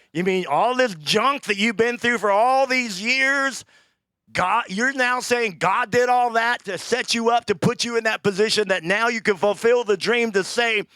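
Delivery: loud, high, slowed preaching; preaching at full force. Real, not acted. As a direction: drive it home to the congregation.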